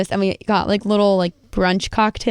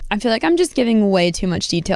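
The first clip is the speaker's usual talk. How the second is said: normal talking voice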